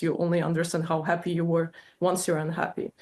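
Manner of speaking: ordinary talk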